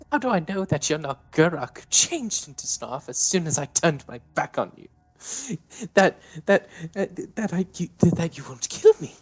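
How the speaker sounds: loud, high accusing rant; paranoid fury. Acted. Davine accusing Snarf over the gun, loud and paranoid. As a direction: spit out distrust at gunpoint.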